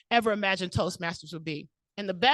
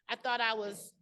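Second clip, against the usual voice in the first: ordinary talk, nothing marked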